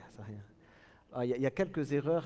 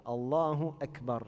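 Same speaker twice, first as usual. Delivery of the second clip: usual speaking voice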